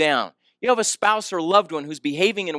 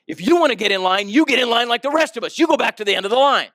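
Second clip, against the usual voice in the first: heated, raised-voice telling-off; angry retort; 7 semitones higher, 5 dB louder than usual. Retold or imitated. Angry man to the woman pleading to stay, scolding. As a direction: scold a queue jumper, higher and forceful. Acted quarrel in airport line.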